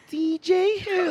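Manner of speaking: very high, slow falsetto; man doing a woman's voice. Shown as a demonstration. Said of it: "I can't make my voice high like that".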